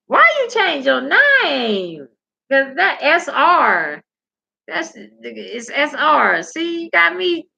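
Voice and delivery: high, slow drawn-out "why you change your name"; teasing high question. Real, not acted. Asking R J why he changed his name, high and teasing.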